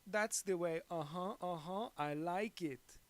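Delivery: high, loud, showy character voice; Goldmember. Retold or imitated. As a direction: do a mock catchphrase, loud and high. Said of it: "he goes like".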